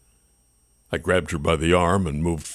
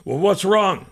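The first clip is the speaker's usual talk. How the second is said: huffy; 12 semitones higher than usual. A narrator's reading. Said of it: "I huffed".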